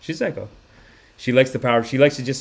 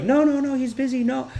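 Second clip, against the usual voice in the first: high, quick, softer refusal; hushing adult impression; 10 semitones higher, 4 dB quieter than usual. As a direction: mimic adults fending off a child. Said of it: "they're like".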